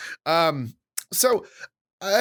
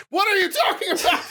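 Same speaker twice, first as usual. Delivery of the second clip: high laughing outburst, much higher; laughing disbelief. Real, not acted. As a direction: blurt it out through laughter. Incredulous at father, laughing.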